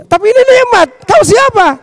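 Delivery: high-pitched acted astonishment; bewildered amazement. Revived sinner asks the stranger who he is, high-pitched bewilderment. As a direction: exclaim it high, then question just as high.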